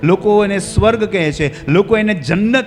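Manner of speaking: high, loud rhythmic list; emphatic preaching. Real, not acted. Preacher lists what people call heaven, emphatic preaching. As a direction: hammer out each name, building.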